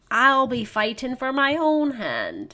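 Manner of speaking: high, slow, proclaimed; mock-heroic declaration. Lady Claire refusing the arranged husband, mock-heroic. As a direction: declare it grandly, drawing the words out.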